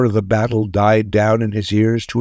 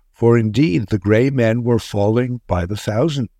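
usual speaking voice

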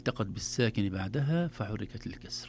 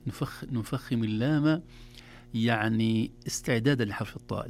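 ordinary talk

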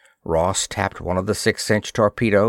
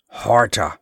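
usual speaking voice

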